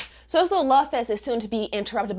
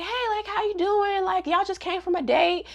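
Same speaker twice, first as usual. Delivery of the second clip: excited; 8 semitones higher, 5 dB quieter than usual. Retold or imitated. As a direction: act out an excited, nosy greeting. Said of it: "So she's, like, excited".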